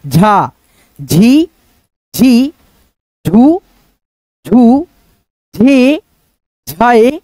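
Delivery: drawn-out, sing-song recital of each syllable; syllable drill. Shown as a demonstration. Teacher reciting the ज syllable chart to students, sing-song. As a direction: chant the barakhadi one by one for pupils. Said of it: "एक एक मात्रा को बैठा करके पढ़ते चलिए".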